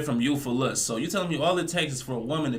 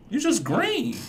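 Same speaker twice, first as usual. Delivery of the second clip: much higher, squeaky mocking pitch; 11 semitones higher, slower than usual. Real, not acted. Teasing the man in the video, squeaky mock. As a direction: squeak it out like a tease.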